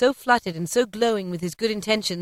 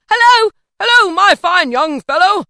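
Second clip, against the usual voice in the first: high, loud, cheery holler; jolly, ringing hail; 11 semitones higher, 13 dB louder than usual. A narrator's reading. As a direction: call down to a boy in the street. Scrooge hails the boy below.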